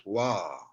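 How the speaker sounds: "wa" with a w, open a; read as wa. Shown as a demonstration. Teacher explains second syllable, long falling "wa". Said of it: "we read them as".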